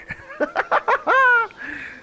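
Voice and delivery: squealing triumphant laugh; high gleeful cackle. Real, not acted. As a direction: cackle with glee. Mocking the beaten croc.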